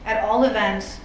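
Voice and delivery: usual speaking voice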